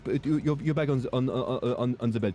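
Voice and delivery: lightly annoyed French airport staff; mock exasperated official; mad French official. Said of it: "they're immediately mad at you".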